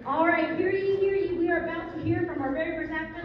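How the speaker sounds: high, loud proclamation; town crier. Pretend. Town crier style introduction of actor.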